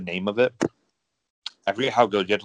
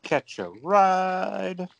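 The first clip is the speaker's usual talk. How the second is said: high, very slow, drawn-out Southern mechanic voice; Scooter impression; 10 semitones higher, much slower than usual. Cohost mimicking the game's Southern mechanic, high and drawn out.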